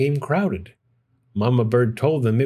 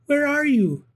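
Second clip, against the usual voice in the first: high squeaky little bat voice; a tiny lost baby bat squeaking; squeaked. A narrator's reading. Baby bat calling for its mother, high squeak. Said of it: "Stella Luna squeaked".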